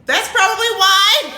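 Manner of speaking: loud, high laughing exclamation; laughing outburst. Real, not acted. Teasing her wife for being gone, loudly laughing. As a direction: burst out with it through laughter, drawn out. Bursts out laughing at wife's answer.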